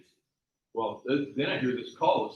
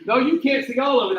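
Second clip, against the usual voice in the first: higher and louder insisting; mock loud insistence; 10 semitones higher, 10 dB louder than usual. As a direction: push back hard on the wife. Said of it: "I said".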